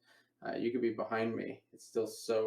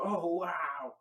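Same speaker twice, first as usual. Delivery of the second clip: mock amazed, higher-pitched reaction; acted amazement; 6 semitones higher than usual. Spectator looking in their hand, acted amazement. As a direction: play the wowed spectator, pitch up.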